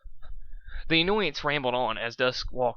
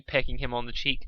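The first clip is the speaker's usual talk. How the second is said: plain reading voice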